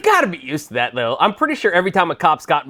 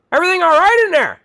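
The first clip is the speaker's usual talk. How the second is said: a high, louder mock cop voice; 13 semitones higher, 4 dB louder than usual. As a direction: parody the cop's nosy question. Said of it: "leans up the window and goes".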